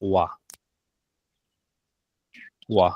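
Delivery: ওয়া said as wa, with no h at all; particle wa. Shown as a demonstration. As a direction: model ওয়া as a clean wa for the class. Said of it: "হা এর উচ্চারণ হবে যে ওয়া এর মতো". (ওয়া উচ্চারণ, কোনো 'হ' ছাড়া)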